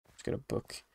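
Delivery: soft unvoiced whisper. Shown as a demonstration. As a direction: breathe it like a secret. Repeats line, second whispered.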